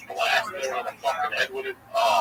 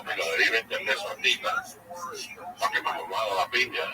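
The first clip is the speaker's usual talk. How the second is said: in Spanish; 6 semitones lower, 4 dB quieter, faster than usual. Retold or imitated. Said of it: "I'm telling this in Spanish".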